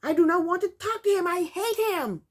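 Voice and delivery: high, loud mock tantrum; hammed-up aggressive outburst. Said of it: "she said very aggressively".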